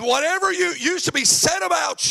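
high, loud, sing-song preaching; preaching at full force. Acted. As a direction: whoop it up to the congregation.

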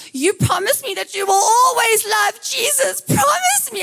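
hammed-up tearful mother; imitating tearful mom. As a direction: mom at bedtime, begging her daughter to promise. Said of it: "then she'd start to cry".

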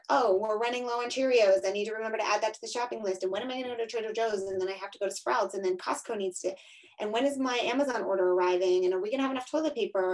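racing mental checklist. Retold or imitated. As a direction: rattle the list off fast, barely breathing. Mom's racing mental to-do list.

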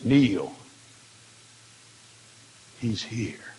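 low, grave, falling to a hush; solemn angelic command. Retold or imitated. Voices angel announcing the Lord.

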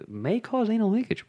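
high, soft, slow offhand delivery; nonchalant. Retold or imitated. Quoting the comedian's medication warning, nonchalant. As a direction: toss out the side effect as if harmless. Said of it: "it acts so nonchalantly".

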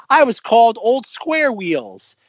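high, slow, hammed-up grievance; Henry. Quoting the third insulted engine's complaint, aggrieved. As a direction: stretch it out like a wounded tattletale. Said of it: "And then Henry goes".

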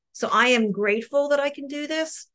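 ordinary delivery

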